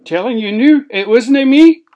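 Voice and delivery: loud protest climbing high; indignant denial. Acted. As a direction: protest your innocence at full volume. Dwarf denies eating the tea.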